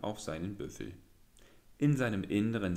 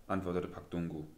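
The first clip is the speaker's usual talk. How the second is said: plain reading voice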